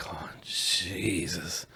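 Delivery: very slow, low, hushed dismay; retold sinking reluctance, drawn out softly; muttered dread. Man asked for a hug, muttered dread.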